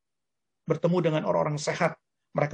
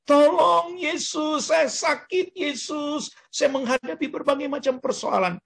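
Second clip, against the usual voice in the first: high-pitched pleading cry for help; acted cry of distress in prayer; crying out to Jesus; 10 semitones higher, slower than usual. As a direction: call out desperately, pitched well up. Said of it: "Ketika kita berteriak".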